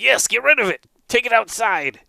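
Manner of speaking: high, insistent character voice; high-pitched eager command; urgent monk. Acted. Monk urges removal of mouse.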